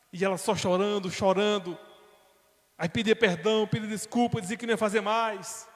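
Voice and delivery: loud, high impassioned sermon storytelling; preaching at full force. Acted. Preacher telling the congregation about the betrayal, impassioned.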